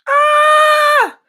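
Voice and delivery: sudden high startled cry; startled shout. Real, not acted. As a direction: yelp in surprise. Connection glitch startles her.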